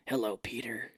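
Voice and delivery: hushed Batman-type voice; whispered, soft superhero greeting; Doctor Strange. Retold or imitated. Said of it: "kind of like a Batman type voice".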